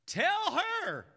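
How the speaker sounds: high loud order; demanding Martha. Acted. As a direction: bark it at Jesus like a spoiled sister.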